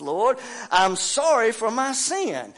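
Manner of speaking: preacher's prayer rising in pitch and volume; building confession. Acted.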